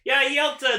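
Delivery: ordinary talk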